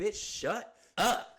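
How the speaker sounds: high, drawn-out mock indignation; indignant retort. Speaker answering shocked normal people, indignant. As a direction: stretch it out high, brushing off the critics.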